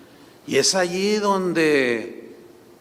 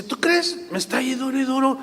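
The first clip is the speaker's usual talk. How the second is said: higher, fast exasperated complaint; mocking husband; 7 semitones higher than usual. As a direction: whine about your nagging wife. Husband complains about wife. (el esposo quejándose de su mujer, voz aguda y rápida)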